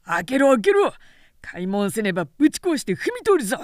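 loud, fast roaring threat; furious pounding shout; thundering shout. Acted. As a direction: bellow at the gate. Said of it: "雷鳴のような人声が轟いていた".

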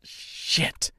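whispered curse; said to herself. A narrator's reading. Dorothy to herself as the bird takes Mister, whispering. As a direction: swear under the breath. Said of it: "she said to herself".

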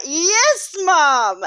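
high, loud, drawn-out cheer; kids cheering. Retold or imitated. Kids celebrate mum getting into the sea, cheering.

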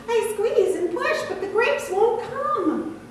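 high, strained branch voice with a laugh in it; squeaky, exasperated vine branch, slowing at the end; complaining grape branch. Acted. Branch complains to Farmer Jones about its grapes, high and exasperated.

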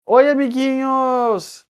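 put-on high, slow sing-song greeting to children; chirpy children's TV presenter voice; kids show host.

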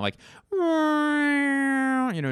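long high drawn-out whooshing wail; imitated sound effect. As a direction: mimic the eerie U-boat surfacing sound, stretched way out. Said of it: "There's this weird sound effect".

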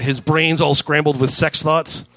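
plain reading voice

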